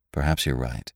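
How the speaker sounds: low, soft grudging admission; sullen. A narrator's reading. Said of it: "I said sullenly".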